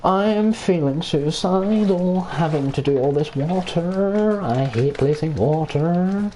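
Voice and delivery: hammed-up suffering over chores; mock despair. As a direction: drag it out like a martyr. Complains about placing water.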